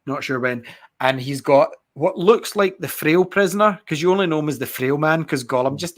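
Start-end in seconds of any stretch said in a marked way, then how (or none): none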